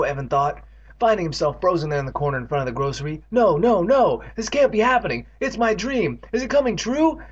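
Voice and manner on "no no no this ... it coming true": panicked. Acted. Evan panics as dream comes true.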